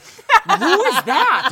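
high, loud, drawn-out mock yell; alarmed yell. Man retelling finding intruder over the bed, alarmed yell. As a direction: act out shock at a stranger over the bed.

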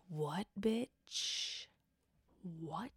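very quiet, drawn-out disbelief; stunned disbelief. Pretend. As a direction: drop almost to nothing, as if stunned. Stunned reaction to the insult.

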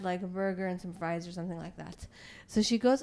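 ordinary talk

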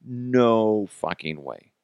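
normal talking voice

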